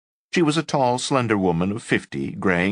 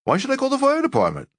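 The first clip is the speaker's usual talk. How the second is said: indignant; faster than usual. Acted. Exasperated quote in story.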